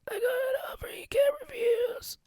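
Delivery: high, very soft wheezy whisper; comical sick-friend voice. Retold or imitated. Friend teases host over the phone in a comical whispery sick voice. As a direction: put on a thin, breath-only sick voice. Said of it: "the way he was doing it".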